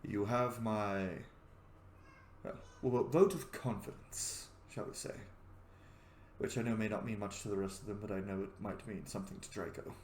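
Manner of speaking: low, soft, measured endorsement; refined god, reassuring. Acted. God gives a mortal his vote of confidence, low and kindly. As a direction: offer support with quiet dignity. Game master plays Bahamut.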